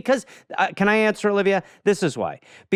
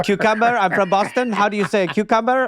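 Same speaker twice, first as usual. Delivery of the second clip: higher-pitched mock Spanish-accent character; Hilaria Baldwin; 6 semitones higher than usual. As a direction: play the fake Spaniard, pitched up and questioning. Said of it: "Incredible character".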